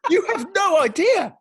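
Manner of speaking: loud, high-pitched indignant rant; mock outrage. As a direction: rant at a kid in loud disbelief. Retold outraged rant at kid.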